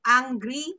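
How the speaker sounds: usual speaking voice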